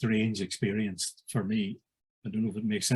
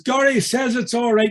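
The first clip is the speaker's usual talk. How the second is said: higher and louder, calling across the crowd; announcing to the room; 11 semitones higher, 5 dB louder than usual. Retold or imitated. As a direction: proclaim it to the whole pavilion. Announcing to two hundred drinkers.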